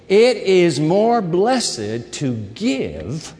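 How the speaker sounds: solemn, drawn-out scripture line; slow emphatic quote. Shown as a demonstration. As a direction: savor each word for the congregation. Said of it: "Jot that down".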